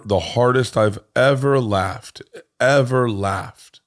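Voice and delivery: slow, stretched ever laughed; dragged-out emphasis. Shown as a demonstration. Stretching ever laughed to stress the best laugh. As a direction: lean hard on ever, drawing it out.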